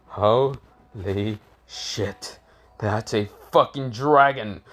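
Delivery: very slow, drawn-out creature voice; stunned snake. Acted. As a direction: stretch it out in awe like a monster. Giant snake sees the dragon.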